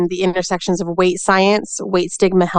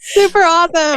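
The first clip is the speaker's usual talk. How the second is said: high, louder cheer; excited; 11 semitones higher, 4 dB louder than usual. Real, not acted. Guest matching host's giddy mood, high cheer. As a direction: cheer it back playfully.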